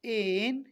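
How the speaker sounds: एन with a long gliding vowel; same-way N. Shown as a demonstration. Teacher modelling N likewise, drawn-out एन. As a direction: stretch the vowel before न in एन. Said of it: "इसी तरह से".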